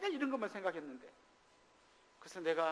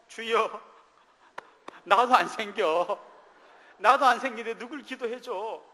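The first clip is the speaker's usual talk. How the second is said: louder, slow, half-joking complaint to God; mock exasperated prayer; 4 semitones higher, 10 dB louder, slower than usual. Pastor jokingly protesting to God in prayer, loud and exasperated. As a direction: cry out to God in comic frustration.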